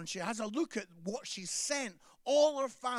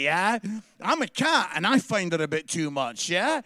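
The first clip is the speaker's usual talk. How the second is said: louder, mock desperate cat pleading; 10 dB louder than usual. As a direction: beg like a trapped cat, hammed up. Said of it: "That cat is looking up going".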